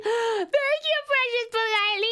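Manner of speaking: high, gasping, delighted thank-you; gasp, then thank them with real delight. Real, not acted. Streamer thanking a chatter for a kind comment, delighted and high.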